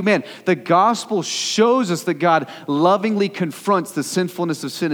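fervent preacher in full flight; impassioned preaching. Real, not acted. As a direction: build in force, punching the key words.

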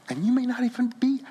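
quick whispered aside. Real, not acted. Pastor reassuring the hearer, whispered. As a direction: slip it in under your breath. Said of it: "whispered aside".

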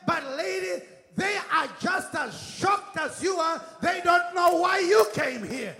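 driving, rousing sermon climax; full-force preaching. Real, not acted. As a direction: hammer each declaration at the congregation.

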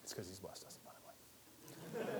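hushed whisper aside; soft, whispered answer to the room. Real, not acted. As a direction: breathe the answer almost silently. Quiet answer to his own question.

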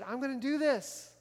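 high, loud burst of eager commitment; fired up. Retold or imitated. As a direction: imitate someone all fired up to volunteer. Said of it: "they're so fired up, they're excited".

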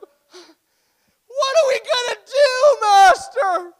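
very high, loud, drawn-out wail; theatrical panicked scream; hammed-up panic. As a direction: cry out to the master in exaggerated despair. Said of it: "it's a bad day".

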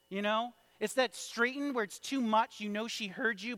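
ordinary talk, nothing marked

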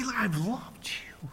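soft whispered line, slow; God speaking softly in a whisper. Acted. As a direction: whisper it like a tender secret. Voicing God's tender words.

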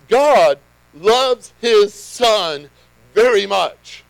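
slow, loud, each word weighted; emphatic preaching. Real, not acted. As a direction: proclaim it big, word by word. Preacher builds up to key point.

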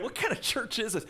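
high, baffled Southern lady; hammed-up puzzled Southern caller; Southern accent. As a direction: squeak it out in a mock Southern accent. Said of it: "I picture this in a Southern accent".

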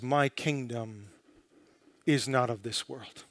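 a quiet, unshaken king answering the governor; calm, confident Jesus. Acted. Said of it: "Calmly, confidently, he says".

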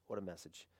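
"What a message" repeated soft and low; soft echo. Shown as a demonstration. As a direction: savour it quietly, almost to yourself. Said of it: "Man, what a message".

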